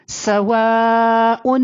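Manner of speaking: سواء with a long-held aa; long madd. Shown as a demonstration. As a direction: hold the aa of سواء very long on a level note. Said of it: "خوب کھینچیں گے۔ کتنا؟ سنیے". (سواء کا الف خوب کھینچ کر)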